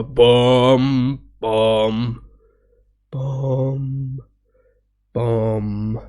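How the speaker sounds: lightly sung music imitation; imitating the music. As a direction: hum it out like the orchestra. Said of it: "That's what's going on".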